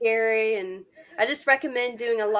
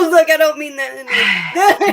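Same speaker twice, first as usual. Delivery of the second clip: laugh-filled, raised-voice backtracking; laughing disclaimer; 3 semitones higher, 9 dB louder, slower than usual. Real, not acted. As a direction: protest while cracking up laughing. Laughing at own accidental pun.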